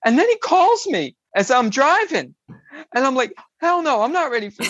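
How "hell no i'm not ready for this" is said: high, rushed, laughing refusal; retold panic. Driver refusing the friend's phone call, panicked. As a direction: refuse in a hurried mock panic. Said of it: "I had such a panic attack".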